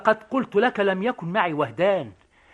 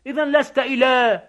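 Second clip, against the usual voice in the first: shouting in quarrel; 5 semitones higher, 5 dB louder than usual. Acted. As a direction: shout it back at the soldier. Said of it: "ما هذا الصياح؟". (يصيح متحديًا)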